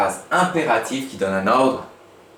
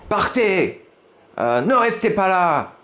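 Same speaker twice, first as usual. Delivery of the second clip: higher, bossy command; shouted order; 6 semitones higher, 3 dB louder, slower than usual. Shown as a demonstration. Teacher demoing a shouted command to class. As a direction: bark the order out. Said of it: "Mais en criant".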